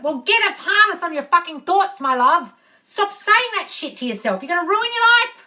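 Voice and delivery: loud, high tough-love rant; exasperated scolding. Real, not acted. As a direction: scold them, rising to a high cry.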